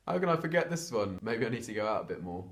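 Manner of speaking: ordinary talk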